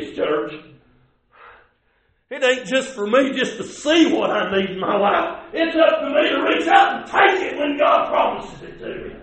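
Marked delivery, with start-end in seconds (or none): 2.3-9.2 emphatic sermon line rising in pitch; preaching building to shout. Real, not acted. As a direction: start firm and climb to a high cry.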